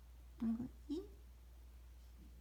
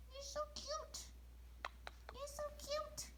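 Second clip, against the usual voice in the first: cooing; 11 semitones higher, 10 dB quieter than usual. Real, not acted. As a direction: coo it sweetly, much higher. Cooing at a cute child.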